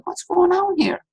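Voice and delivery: lightly voiced grandfather, high and slow; a hint of a concerned old man; grandfather asking. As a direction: grandpa walking over to check on the boy. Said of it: "He said".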